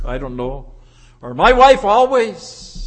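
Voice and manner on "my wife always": loud, higher, drawn-out complaint; acted public complaint, raised voice; fault-finding husband. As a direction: voice a husband slowly, loudly complaining about his wife. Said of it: "expose the faults".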